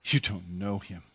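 soft, low, hurt disbelief; hearing his own friend's denial, stunned and quiet. Acted. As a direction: let it drop as the heart sinks. Heart sinks hearing the denial.

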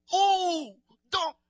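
mock a New Orleans kid calling out; imitating a schoolkid. Kid in the hallway teasing him, high and loud. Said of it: "this is how they talk".

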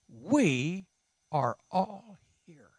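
We set apart by a pause, then are all here said slowly; stress on We. Shown as a demonstration. Preacher revealing the key word to the congregation, slow and stressed on We. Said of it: "How about we?".

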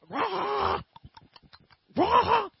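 imitating refugees yelling around their suckers; mock crowd roar. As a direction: growl out the crowd's yell.

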